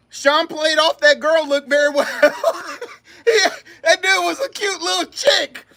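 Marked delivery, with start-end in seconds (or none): 2.1-5.6 high laughing voice. Real, not acted. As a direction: laugh through the words. Laughs at memory.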